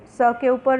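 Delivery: usual speaking voice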